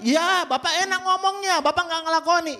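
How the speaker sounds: high, mocking retort; sceptical listener. Listener scoffing at the preacher, high and mocking. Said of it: "Mungkin Anda berkata".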